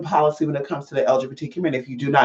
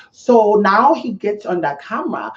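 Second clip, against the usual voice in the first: slow, higher-pitched fake accent drifting toward Vietnamese; botched mock French accent; 5 semitones higher than usual. Mocking his on-camera act, botched French accent. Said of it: "now it sounds a little Vietnamese there".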